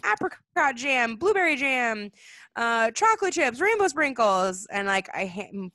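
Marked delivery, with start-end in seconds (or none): 0.0-4.7 excited listing. Retold or imitated. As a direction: savour each topping like a thrilled kid. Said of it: "So I'm like".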